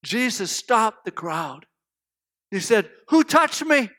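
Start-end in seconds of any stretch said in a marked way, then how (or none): 3.1-3.9 higher, louder demand; calling out. Acted. Jesus stops the crowd to ask, calling out. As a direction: call it out over a crowd.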